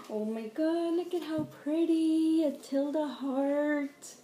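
real excited squeal-talk; thrilled surprise. Real, not acted. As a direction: gush in amazement, stretching the last words. Thrilled reaction to surprise gift.

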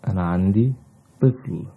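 ordinary talk

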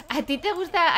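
playful singsong ribbing; teasing. Pretend. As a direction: tease her about her crush. Teasing a Sim about a crush.

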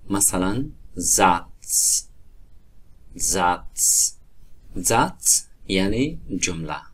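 پلاتس and زاتس ending in a crisp ts. Shown as a demonstration.

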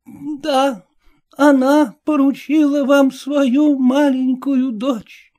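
high, slow solemn pronouncement; the old lady solemnly delivering news. A narrator's reading. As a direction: announce it gravely like an old dame. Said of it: "сказала торжественно".

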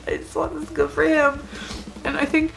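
pushing the words out through crying. Real, not acted. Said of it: "'cause I'm crying".

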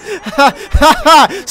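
laughing at own joke. Real, not acted. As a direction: burst of amused laughter. Amused at own joke on air.

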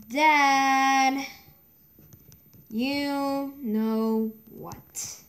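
very slow, halting, word by word; dictating while typing. Real, not acted. Player typing about someone who told, dictating slowly. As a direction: murmur it in fragments with long pauses.

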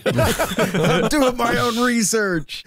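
high, drawn-out mocking voice; mock excitement. Mocking the eager amateur researchers, high drawn-out glee.

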